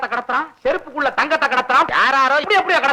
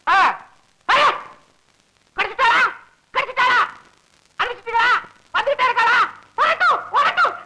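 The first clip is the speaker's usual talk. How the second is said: high-pitched, breathless phone excitement; excited questioning; 8 semitones higher than usual. Acted. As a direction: pepper the caller with eager high questions. Excited at phone news of capture.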